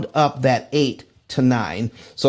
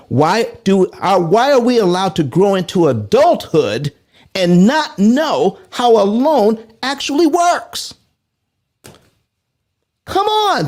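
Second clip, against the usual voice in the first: raised, insistent outburst to the crowd; impassioned appeal; 7 semitones higher, 3 dB louder than usual. Real, not acted. Host urging viewers about loan education, impassioned appeal. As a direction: press the question, voice climbing high.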